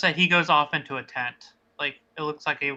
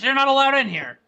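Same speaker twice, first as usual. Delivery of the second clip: angry NPC; 8 semitones higher, 4 dB louder than usual. Acted. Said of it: "He goes".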